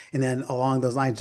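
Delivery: ordinary delivery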